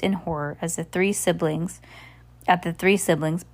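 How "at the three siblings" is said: usual speaking voice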